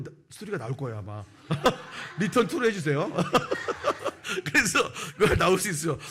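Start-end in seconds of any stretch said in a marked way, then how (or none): 1.3-2.1 high, loud burst of laughter; real laugh. Real, not acted. Laughs at his film joke. 2.2-3.1 high, loud words through laughter; laughing. Real, not acted. Joking amid laughter. 3.1-4.4 very high, breathless peal of laughter; real laugh. Real, not acted. Laughs at his film joke. 4.4-6.0 high, loud, still cracking up; laughing. Real, not acted. Still laughing at his joke.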